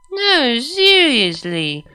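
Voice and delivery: put-on slow, high, drunk insistence; insisting. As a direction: insist she means it. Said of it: "she insisted".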